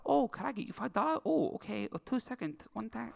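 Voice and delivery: imitating the instructor's Chinese accent; mock Chinese accent impression. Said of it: "a quick impression of what she was doing".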